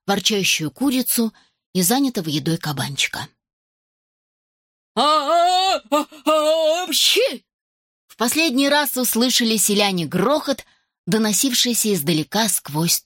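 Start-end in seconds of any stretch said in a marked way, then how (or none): 5.0-7.5 big sneeze. Acted. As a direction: gasp then sneeze hard. Dragon sneezes again.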